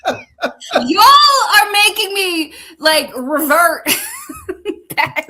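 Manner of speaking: high, loud, breaking into laughter; laughing complaint. Real, not acted. Blaming co-hosts for the swearing, laughing complaint. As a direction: playfully protest while cracking up.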